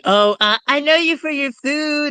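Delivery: put-on high, eager kid's voice, pitched well up; imitating a little kid. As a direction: squeak out an answer like a small child at daycare. Kids answering the mascot.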